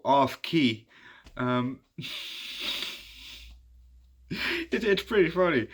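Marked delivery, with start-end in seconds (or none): 2.0-3.5 breathy, quiet wheezing laugh; real amused laugh, nearly soundless. Real, not acted. Amused by the off-key singing. 4.3-5.7 high, amused laughing voice; laughing while talking. Real, not acted. Laughing about the off-key singing.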